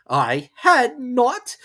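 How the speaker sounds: very slow, high, loud angry mouse; angry cry. Acted. Mouse snapping at Alice, slow and angry. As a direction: bite each word out. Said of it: "cried the mouse sharply and very angrily".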